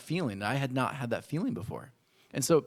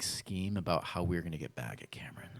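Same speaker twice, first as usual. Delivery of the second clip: conspiratorial whisper; 4 semitones lower, 5 dB quieter than usual. Retold or imitated. Kids plotting revenge in secret.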